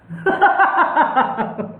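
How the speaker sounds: mocking laugh. Real, not acted. Reacts to quoted medium-slow jibe. (मज़ाक उड़ाती ज़ोरदार हँसी)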